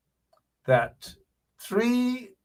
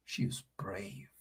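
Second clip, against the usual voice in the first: whisper; 2 semitones lower, 16 dB quieter than usual. Real, not acted. Admiring aside about the attendant.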